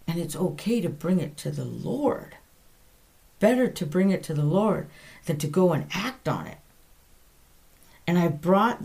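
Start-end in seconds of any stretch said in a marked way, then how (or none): none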